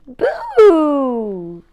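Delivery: loud spooky boo; playful boo. Acted. Crayons add a boo.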